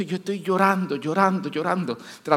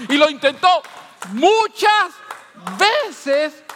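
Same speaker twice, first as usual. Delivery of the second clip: rising from firm to a high, loud cry, phrase by phrase; building preaching; 7 semitones higher, 6 dB louder than usual. Real, not acted. Preacher builds to a loud cry over the congregation. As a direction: climb each repeat louder and higher till you shout. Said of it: "preacher repeats, building".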